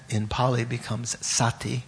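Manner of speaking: usual speaking voice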